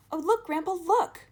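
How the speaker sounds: put-on high, loud, thrilled child; bubbling over with wonder; excited cry. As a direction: point and call out in delight. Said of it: "cried Charlie".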